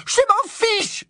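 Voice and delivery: extremely high, screamed dismissal; hurlement furieux. Acted. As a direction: scream it in fury. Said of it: "hurla-t-elle". (hurlement suraigu de colère)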